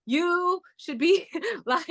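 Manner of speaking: high, slow words breaking into a laugh. Retold or imitated. Laughing as she retells the message to her friend. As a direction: giggle through the quote.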